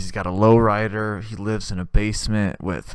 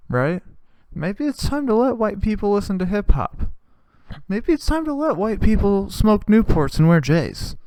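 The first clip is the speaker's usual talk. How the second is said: raised, hammed-up rallying appeal; mock-earnest public appeal, pitched up; mock activist plea; 7 semitones higher than usual. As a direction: plead a silly cause like a campaigner.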